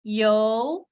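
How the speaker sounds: "yo" rising upward; second tone yo. Shown as a demonstration. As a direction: let "yo" climb like a question. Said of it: "as you change the tone".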